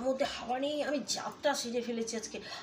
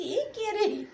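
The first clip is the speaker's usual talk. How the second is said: high, louder mock-startled question; raised, incredulous mimicry; retold surprised question; 9 semitones higher, 5 dB louder, much slower than usual. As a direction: imitate someone demanding who this is.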